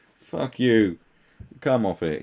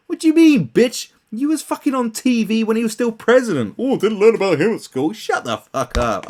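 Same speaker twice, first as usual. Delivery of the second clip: loud, high outraged yelling; furious rant; 11 semitones higher, 8 dB louder than usual. Real, not acted. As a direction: tear into her at full volume. Ranting at the actress.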